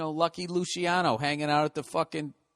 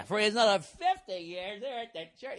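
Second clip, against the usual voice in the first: preacher impression again; 6 semitones higher than usual. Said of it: "repeating preacher's line".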